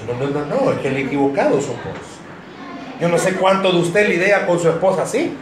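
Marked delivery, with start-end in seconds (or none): 0.1-2.1 quick retort. Retold or imitated. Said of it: "Rápido dice algo".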